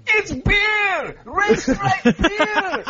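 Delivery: Jamaican ad voice. Acted. Co-host yelling the beer slogan in a hammed-up Jamaican accent. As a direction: sell the beer like an ad. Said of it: "Mine turns a little Scottish".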